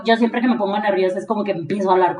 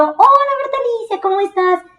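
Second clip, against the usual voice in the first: sky-high sugary fake greeting; forced cheerfulness; 10 semitones higher than usual. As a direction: sweeten it way up to hide irritation.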